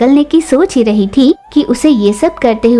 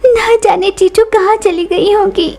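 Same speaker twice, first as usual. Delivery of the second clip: much higher, wailing regretful voice; lamenting Lucy; 8 semitones higher than usual. Acted. Pigeon bewailing her lost luck, high tearful lament. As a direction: bemoan lost fortune, half crying. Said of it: "रोने लगी".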